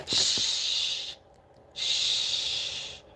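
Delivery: soft, drawn-out shh; long shushing. Acted. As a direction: hush someone with slow shushes. Acts shushing the listener.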